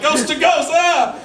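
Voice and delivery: high, comic war yell; mock battle cry. Brother sacrificing sister to the ghost, mock battle cry. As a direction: call out high, ending in a yell.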